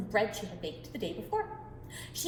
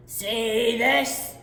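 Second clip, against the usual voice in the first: boasting giant; 7 dB louder than usual. Acted.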